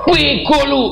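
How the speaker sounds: very high, explosive mock-angry shout; angry yell. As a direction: shout the insult as if losing your temper. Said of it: "Deket tapi teriak". (bentakan marah)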